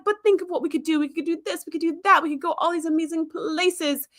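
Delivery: selling her on adventures; pleading enthusiasm. Retold or imitated. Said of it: "And he's like".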